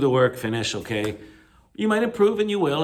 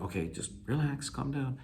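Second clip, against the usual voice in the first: soft, slightly higher soothing dentist; calming dentist; 5 semitones higher, 7 dB quieter than usual. Acted. Dentist to a nervous patient, soft and calming. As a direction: gently settle a patient, voice kept soft. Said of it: "your doctor or your dentist trying to".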